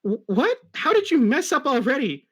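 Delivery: exasperated. Acted. Tails exasperated at Sonic.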